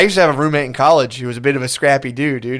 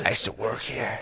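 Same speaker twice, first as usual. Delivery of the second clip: hushed voiceless whisper; mock whisper; 5 semitones lower, 14 dB quieter than usual. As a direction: whisper it as a washed-up ex-bouncer. Whispered bit as washed-up bouncer.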